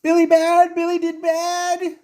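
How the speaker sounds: put-on high, loud wail of a frightened child; childlike screaming. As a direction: scream for mama like a guilty child. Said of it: "he's screaming".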